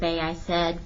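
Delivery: ordinary delivery, nothing marked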